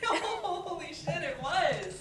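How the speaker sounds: high, loud, laughing through the words; cracking up at the memory; laughing disbelief. Acted. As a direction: burst out laughing as you confirm it.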